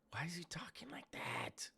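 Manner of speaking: whispered disbelief. Real, not acted. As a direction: hush it out in disbelief. Reacting in hushed disbelief.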